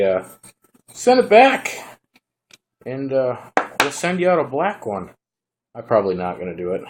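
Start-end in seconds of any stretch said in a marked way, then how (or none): 0.9-5.1 high, loud put-on customer-service voice; imitating the seller telling him to send it back; mock company reply. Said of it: "if they say".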